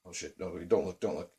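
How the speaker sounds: very soft, low, under the breath; nervous hushed warning. Retold or imitated. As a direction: imitate a friend quietly urging caution. Scared friend avoiding men behind them.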